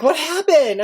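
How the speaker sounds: put-on high, wondering kid voice; curious kids. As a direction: ask like a worried child. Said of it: "And they're like".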